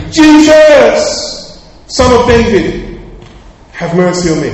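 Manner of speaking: full high shout, easing on the last plea. Acted. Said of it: "He cried out even louder".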